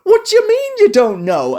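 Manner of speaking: pitched far up, disbelieving; incredulous what. Retold or imitated. Fan reacting to Yugi forgetting them, high disbelief. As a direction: act floored by the news. And I'm like.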